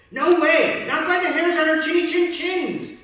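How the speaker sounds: high and loud defiant refusal; crying out. Acted. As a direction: shout back together. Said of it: "cried the three little pigs".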